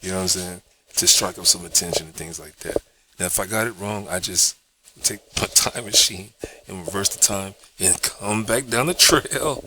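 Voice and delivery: ordinary talk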